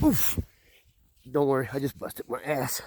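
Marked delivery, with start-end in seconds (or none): 0.0-0.4 sharp high grunt from a fall; knocked-wind oof as you hit the ground; pained oof. Real, not acted. Falls on the trail.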